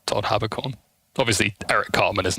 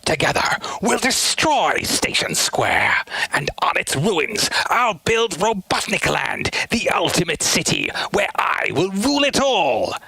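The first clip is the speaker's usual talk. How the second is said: raised scheming villain rasp; Eggman voice; 8 semitones higher than usual. Pretend. Streamer continuing a villain voice, gloating. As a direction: plot world domination with glee.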